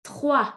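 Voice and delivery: Trois said as twa with no final S; trois, S silent. Shown as a demonstration. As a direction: end Trois on the open wa, no S. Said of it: "We do not pronounce the S".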